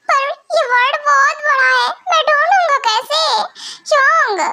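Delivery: high, helpless whine; crying. Acted. Vampire whining to Yoong about searching, crying. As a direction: wail the big brother's name in despair. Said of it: "अरे मैं रो क्यों रहा हूँ?".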